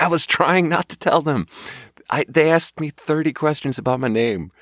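high-pitched mock defensive plea; exasperated protest. Waiter defending himself to coworkers, exasperated. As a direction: plead your innocence, pitch jumping up.

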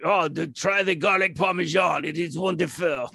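gushing French waiter; French accent. Acted. Playing French waiter recommending garlic parmesan, French accent. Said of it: "That's my French accent".

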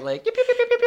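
very high, loud yip yip yip; imitating a high little dog yapping; yippy dog barks. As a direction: yap like a tiny dog. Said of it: "the yippee high bark".

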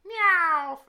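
high loud cat meow; Meowth meow. Pretend. Cohost does a Meowth cry, high meow. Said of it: "I can do pretty good Meowth".

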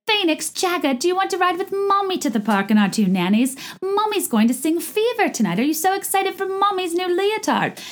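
high, gushing mock-mom baby talk; Dorit mommy voice. Podcaster imitating a TV mom addressing her children, syrupy mommy voice. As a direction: coo it as a hammed-up showy mother. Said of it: "Dorit, uh, in every scene was like".

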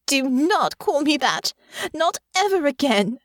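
high, emphatic forbidding; indignant, cutting command; angry snap. Acted. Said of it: "Honor snapped".

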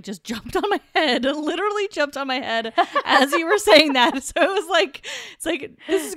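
laughing while telling it; giggling through the words. Real, not acted. Laughing about cat on head.

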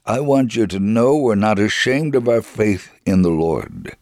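ordinary delivery

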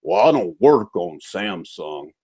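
slow, low, self-satisfied boast; mock badge of pride. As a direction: imitate a proud tech, slow and low. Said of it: "say it like it's a badge of pride".

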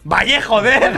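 exasperated valle, joder; annoyed. Retold or imitated. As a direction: lose patience with the teacher. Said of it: "ya me enfada".

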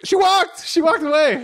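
very high, squealed outburst; mock exasperated cry. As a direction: squeal the obvious in comic disbelief. Acted exasperated reply to commissioner.

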